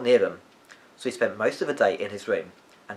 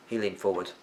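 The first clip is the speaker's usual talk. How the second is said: ordinary delivery, nothing marked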